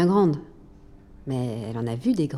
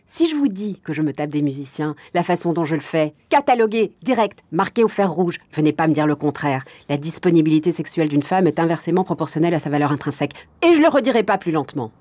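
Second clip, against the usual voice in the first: indignant tirade; 2 semitones higher, 4 dB louder than usual. Acted. Club owner defending her sex life to progressive men, indignant. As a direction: hammer it at your critics.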